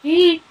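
high-pitched put-on hee-hee; playful giggle. Player looking so happy, playful high giggle. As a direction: squeak out a gleeful little giggle.

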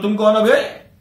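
narrated cocky outburst; haughty challenge. A narrator's reading. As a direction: challenge them as if you outrank them. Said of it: "Munshi जी साहब अकड़ गए".

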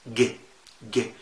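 ج as a hard Egyptian G; Egyptian G sound. Shown as a demonstration. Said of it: "زي الجيم المصرية". (ج زي الجيم المصرية)

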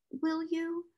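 put-on small, high, soft child voice; whimper. A narrator's reading. Consoled child is offered a story, whimpering. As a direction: whimper it softly, pitch up. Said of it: "whimpered Lottie".

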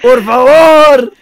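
loud, high, drawn-out begging; long pleading yell; shouted plea. Real, not acted. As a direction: cry it out slowly, imploring. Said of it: "Suplicas a gritos".

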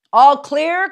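loud, high call from below; cry. A narrator's reading. A carnival man below checking the grounds, loud high cry. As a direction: call it across the dark midway. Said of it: "cried a voice below".

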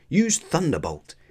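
high-pitched, eager order; battle command. A narrator's reading. Ash commanding Pikachu in battle, eager command. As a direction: order the attack with urgency.

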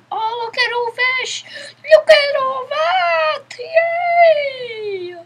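high, loud, delighted squeal; squealing with joy. Real, not acted. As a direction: shriek with happy excitement. Child overjoyed at finding gear.

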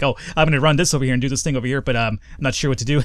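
fast nervous rambling commentary; nervous fast talking. Retold or imitated. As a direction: rush through the words, nervous. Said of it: "talking like this".

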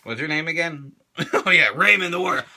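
usual speaking voice

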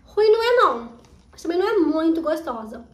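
chewing while talking; talking between bites; mouth full. Real, not acted. Seen 1: Left speaker chews popcorn eaten at -4, mouth working while talking.

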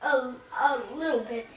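slow, soft, mouth still burning; mouth burning from spicy food. Real, not acted. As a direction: speak slowly with a burning tongue. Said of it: "is it feeling better".

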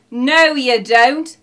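slow, very loud shout; yelling. A narrator's reading. Neighbours burst in on the robbers, yelling. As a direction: yell to stop them in their tracks. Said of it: "yelled their neighbours".

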